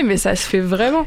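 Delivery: ordinary talk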